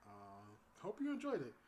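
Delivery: ordinary talk, nothing marked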